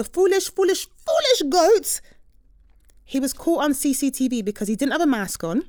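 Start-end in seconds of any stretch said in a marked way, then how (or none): 0.0-2.0 high, louder, slow mocking sing-song; mocking scorn. Host ridiculing the careless thief, slow high mockery. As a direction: drag it out to ridicule him.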